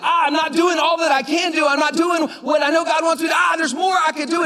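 high, strained cry of frustration; frustrated outburst. Retold or imitated. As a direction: vent it like you keep hitting a limit. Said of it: "I felt like there was a lid, like".

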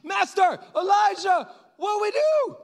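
very high, loud shouting; exclamatory panicked cry. Acted. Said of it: "exclamation point".

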